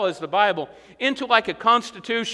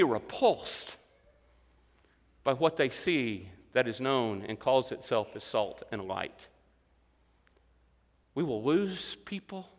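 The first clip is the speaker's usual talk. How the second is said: hushed, grave; 9 semitones lower, 9 dB quieter, slower than usual. Real, not acted. Preacher dropping to a hush.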